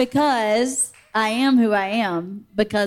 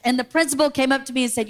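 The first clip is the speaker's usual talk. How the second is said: normal talking voice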